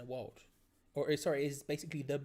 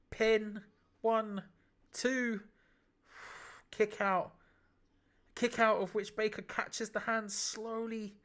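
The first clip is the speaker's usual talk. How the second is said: ordinary talk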